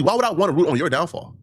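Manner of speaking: usual speaking voice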